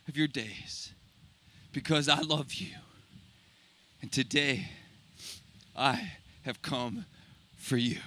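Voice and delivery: low, soft, understated heartfelt pleading; tender, measured reassurance with pauses between phrases; tearful tenderness. Retold or imitated. Husband telling his wife he has come for her, tearful tenderness. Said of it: "with tears running down his face".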